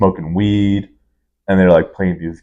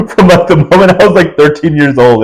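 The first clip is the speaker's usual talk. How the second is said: laughing; 8 semitones higher than usual. Real, not acted. Laughs while telling party story.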